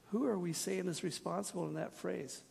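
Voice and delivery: normal talking voice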